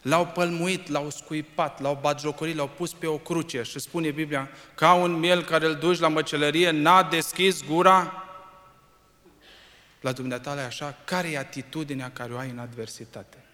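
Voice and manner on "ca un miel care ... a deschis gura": loud, high proclaiming; fiery preacher voice; preaching at full force. Acted. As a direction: declaim the verse, slowing at the end. Preaching climax on scripture.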